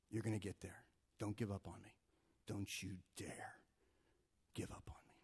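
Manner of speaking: put-on God softly urging his child to keep going; gentle God voice. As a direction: coax him not to give up. God encouraging the believer gently.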